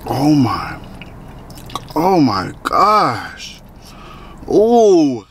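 talking while chewing; savoring between bites; mouth full of food. Real, not acted. Seen 0: Took a bite just before; jaw working, lips parted mid-chew while speaking.

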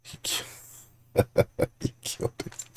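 breathy whisper, laughing; whispering through laughter. Real, not acted. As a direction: whisper it while giggling.

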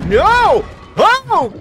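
high, loud shriek of shock and disgust; jolted into a real scream; startled scream. Real, not acted. Startled by a grotesque monster.

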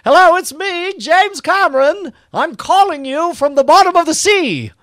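hammed-up high James Cameron voice; James Cameron impression. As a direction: play him as a rich eccentric filmmaker phoning in. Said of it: "he would say".

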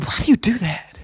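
hushed, whispered question; imitated whisper. Preacher next to him asks about the hopping, imitated whisper. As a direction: whisper it like a puzzled neighbour in church.

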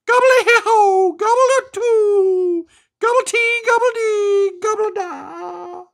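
high, loud gobbling turkey chant; turkey gobble. A narrator's reading. Turkey Wobble gobbles his thanks to the family, high and loud. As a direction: let it all out like a joyful turkey. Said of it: "Wobble let it all fly out".

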